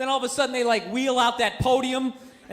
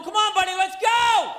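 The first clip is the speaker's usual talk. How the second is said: imitated conductor's high, impatient shout; raised voice climbing to a hollered GO; giving a rough time; 6 semitones higher, 3 dB louder than usual. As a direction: yell to hurry a passenger along. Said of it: "starts giving the guy a rough time".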